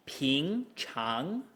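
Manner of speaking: 同学, 平常, 回答 each spoken slowly and cleanly toned; tone demo. Shown as a demonstration. Teacher modelling vocabulary tones for students, carefully. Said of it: "Our next word".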